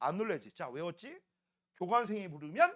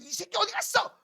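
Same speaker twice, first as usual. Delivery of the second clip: mock seething, pitched up; huffing angrily; 11 semitones higher than usual. Student hunting for the missing classmate, mock huffing anger. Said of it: "씩씩거리면".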